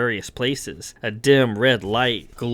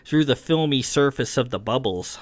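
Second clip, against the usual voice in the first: plain reading voice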